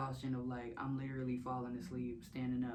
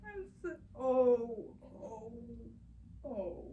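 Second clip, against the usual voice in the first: crying; 7 semitones higher, slower than usual. Real, not acted. Overwhelmed recalling labor.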